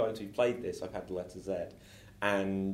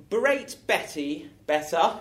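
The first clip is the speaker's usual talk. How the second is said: slow deliberate; 4 semitones higher, 3 dB louder, slower than usual. Acted. Improv scene character line.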